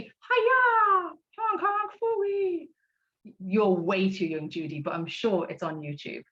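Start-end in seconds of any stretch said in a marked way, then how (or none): 0.0-1.1 high, far-off cartoon hero battle cry; cartoon karate cry. Teacher mimics cartoon hero's karate yell. 1.4-2.7 high, slow "Hong Kong Phooey!"; cartoon title call. Teacher sings out "Hong Kong Phooey!" to the class. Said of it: "Remember Hong Kong Phooey?".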